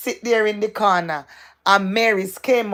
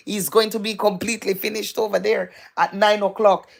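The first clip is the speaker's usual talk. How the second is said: ordinary delivery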